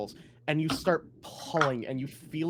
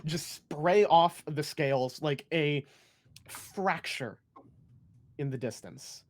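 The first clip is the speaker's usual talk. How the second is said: dramatic scene narration; 6 semitones higher, 5 dB louder than usual. Acted. GM describes attack result.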